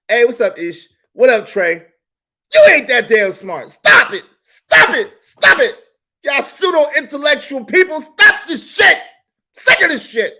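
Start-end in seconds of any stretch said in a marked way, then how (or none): 2.5-10.3 loud, high exasperated yelling; fed-up rant. Real, not acted. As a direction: tear into them, sick of it. Ranting at pseudo-intellectuals.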